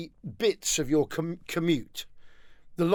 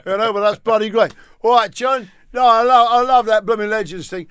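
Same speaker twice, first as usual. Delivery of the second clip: higher, loud fan calling out; enthusiastic punter; 3 semitones higher, 7 dB louder than usual. Acted. Fan stopping the host in the street, loud and keen. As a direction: hail him like a keen fan.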